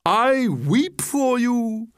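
high, slow theatrical wail; mock weeping. Walrus pretends pity for the oysters, mock weeping. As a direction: lament with long swooping vowels. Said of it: "With sobs and tears".

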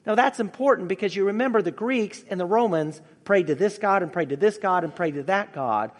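usual speaking voice

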